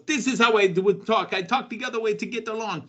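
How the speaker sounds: high-pitched put-on accent, mimicking Apollo Crews; mock Apollo Crews accent. Host mocking a wrestler's made-up accent, exaggerated accent. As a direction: imitate a wrestler's invented accent. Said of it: "Apollo Crews for creating an accent".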